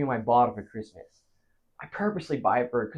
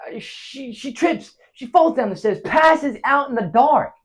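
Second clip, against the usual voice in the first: high, rising account of a fall; agitated storytelling; 9 semitones higher, 4 dB louder than usual. Acted. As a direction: start hushed and build loud, drawing out the end. Acting out Myra's fall.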